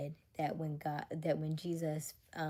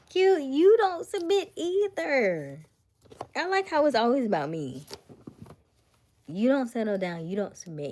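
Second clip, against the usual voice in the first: loud teasing callout; 8 semitones higher, 11 dB louder than usual. Real, not acted. Speaker teasing a friend about submitting, loud mock outrage. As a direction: call out Q with big playful outrage.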